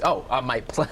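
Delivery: ordinary talk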